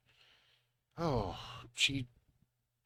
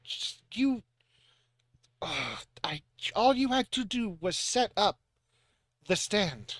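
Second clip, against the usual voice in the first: higher, scolding mom voice, fed up; a mother berating a clumsy teen; exasperated mother scolding; 6 semitones higher than usual. Acted. Mother scolds the teenager who knocked over the vegetable display, exasperated and higher.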